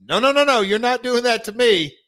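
high, loud cocky heel refusal; heel promo voice. Retold or imitated. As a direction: snap back like a wrestling heel. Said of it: "You gotta tell him".

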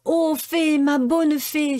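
high, slow desperate plea; pleading. A narrator's reading. Pinocchio begging the good fairy, slow high plea. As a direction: beg the fairy with everything. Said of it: "supplia alors la marionnette".

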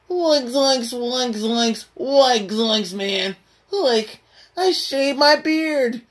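high, strained cartoon slacker demo; Shaggy. Shown as a demonstration. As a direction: yelp catchphrases as a goofy high-voiced sidekick. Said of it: "when you doing the zoink voice".

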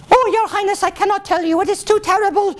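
very high, melodramatic lady voice; distressed lady in waiting. Acted. Lady in waiting refusing to tell her highness, high and horrified. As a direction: wail it in mock horror.

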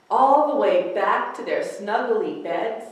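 ordinary delivery, nothing marked

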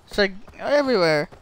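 usual speaking voice

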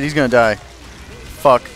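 usual speaking voice

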